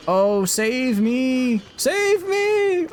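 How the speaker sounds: high fluttering mock cries for help. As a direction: wail for rescue up high and slow. Units under attack in war game.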